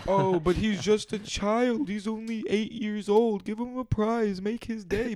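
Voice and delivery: high, drawn-out mock sympathy; mock pleading official. Park official asks booth worker for a prize, mock pleading. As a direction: plead for the kid in a hammed-up high voice.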